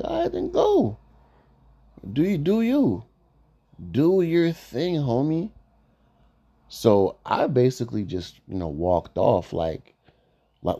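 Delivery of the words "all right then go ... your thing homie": high mocking dismissal. Retold dismissal of the coworker.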